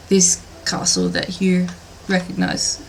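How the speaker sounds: ordinary delivery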